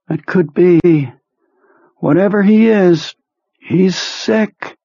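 high, slow, drained voice of a sick man; sick psychic Perlmutter. Acted. Ill telepath reporting to his boss, high and drained. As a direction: sound weak and washed out.

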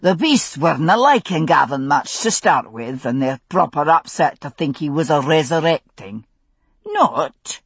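easygoing Scots grumble; gruff Scots. Acted. Duncan explaining the horses' fright, a hint of gruff Scots. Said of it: "said a gruff voice".

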